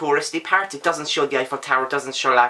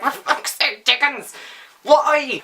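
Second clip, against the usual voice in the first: much higher, mock-exasperated yell at Dickens; exasperated outburst; 9 semitones higher, slower than usual. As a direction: throw up your hands in mock despair.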